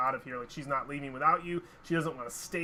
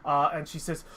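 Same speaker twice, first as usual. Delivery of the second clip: normal talking voice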